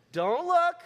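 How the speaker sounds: teasing command to the congregation; playful warning. Real, not acted. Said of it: "don't look at it".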